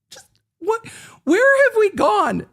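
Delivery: laugh-cry in total disbelief at the courtroom; incredulous laughing squeal. Real, not acted. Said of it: "And I'm like".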